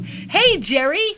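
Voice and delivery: ordinary delivery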